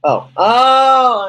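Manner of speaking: loud high "oh, oh"; sudden realization. Real, not acted. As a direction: burst out as it clicks.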